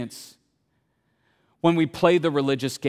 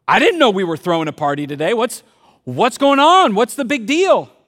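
rising, louder bewildered questions; puzzled brother; 4 semitones higher, 7 dB louder than usual. Retold or imitated. Older brother asking a servant about the party, bewildered. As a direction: demand to know what's going on. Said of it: "the older brother's like".

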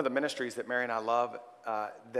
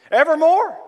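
shouted proclamation; 17 dB louder than usual. Real, not acted. Sermon climax, shouted proclamation.

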